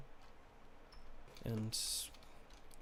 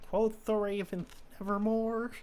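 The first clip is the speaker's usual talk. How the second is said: mock-grand poem reading, pitched up; dramatic recitation; 9 semitones higher, 4 dB louder than usual. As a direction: ham up the famous line like a stage poet. Quoting Poe's poem dramatically.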